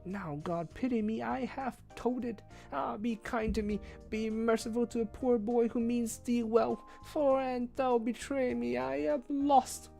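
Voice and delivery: put-on hushed, fearful high boy voice. A narrator's reading. Page confides Merlin's spell to the prisoner, hushed and afraid. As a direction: confide a deadly secret, scared. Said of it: "told me his fearful news in a whisper".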